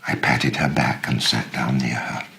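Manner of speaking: usual speaking voice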